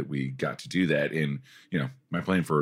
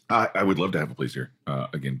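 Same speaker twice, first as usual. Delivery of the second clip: ordinary talk, nothing marked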